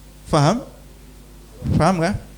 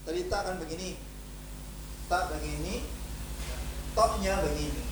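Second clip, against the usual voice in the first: very quiet aside; 3 semitones higher, 17 dB quieter than usual. Real, not acted. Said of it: "teacher speaks very softly".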